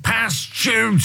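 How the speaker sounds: mock coaching shout. Imitating what young English players are told, mock shouted orders. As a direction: call out like a touchline coach.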